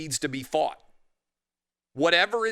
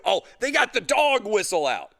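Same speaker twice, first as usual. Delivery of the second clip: mocking voice; 7 semitones higher, 3 dB louder than usual. Mocking left-wingers.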